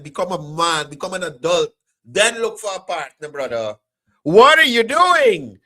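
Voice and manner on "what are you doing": high, loud, slow drawn-out mock taunt; young brothers' mocking call. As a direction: sneer it like the young guys do. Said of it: "As young brothers say".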